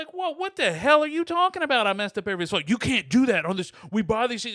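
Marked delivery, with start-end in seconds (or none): 0.1-2.4 high, loud outraged question; indignant disbelief. Retold or imitated. Caller to ranting agent, high loud indignation. 2.7-4.6 high, loud scolding; angry agent. Retold or imitated. Agent scolding caller on phone, loud.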